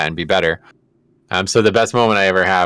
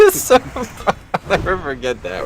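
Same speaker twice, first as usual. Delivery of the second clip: laughing; 12 semitones higher, slower than usual. Real, not acted. Still laughing after punchline.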